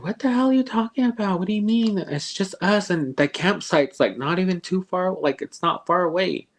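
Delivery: high, agitated disbelief; exasperated outburst. Retold or imitated. Uncle rattled, scolding the kids for joking. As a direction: push back at them, rattled and high.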